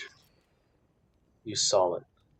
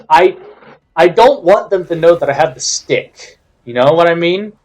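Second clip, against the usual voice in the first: loud, high exasperated rant; livid; 7 semitones higher, 12 dB louder than usual. Real, not acted. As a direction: vent it loudly at the opponent, pitched up. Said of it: "I'm kinda livid".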